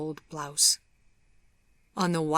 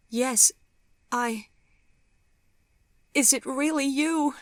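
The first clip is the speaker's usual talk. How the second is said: high, halting, tearful voice; stammering through tears; tearful disbelief; 9 semitones higher, 4 dB louder than usual. A narrator's reading. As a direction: ask it high and overwhelmed, close to tears. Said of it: "Hot tears were streaming down her face".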